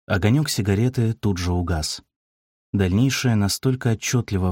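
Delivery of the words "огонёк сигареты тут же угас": usual speaking voice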